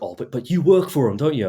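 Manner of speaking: imitating pointed questioning. Customer challenging me about my co-workers, pointedly. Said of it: "he was like".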